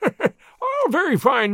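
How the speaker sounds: high forced laugh breaking into speech; insincere laughter. A narrator's reading. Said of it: "burst into insincere laughter".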